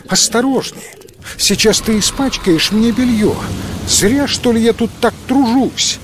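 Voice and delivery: высокий громкий возглас; возмущённо вскрикнуть; восклицание. A narrator's reading. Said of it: "та воскликнула". (громкое восклицание девушки)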